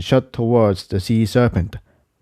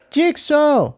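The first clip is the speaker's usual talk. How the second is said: high, far-carrying shout of a name; shouting for her friend in the fight; Incendia shouting. Acted. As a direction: call out urgently in a much higher voice. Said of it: "she shouted".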